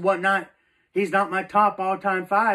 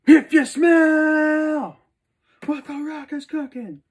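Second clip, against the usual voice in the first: Rock catchphrase; 10 semitones higher, 7 dB louder than usual. Retold or imitated. Reciting the Rock's catchphrase.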